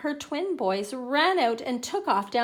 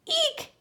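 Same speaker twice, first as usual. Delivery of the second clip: very high-pitched squeal; startled screech. A narrator's reading. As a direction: squeal as if hit by sudden cold. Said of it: "Harry screeched".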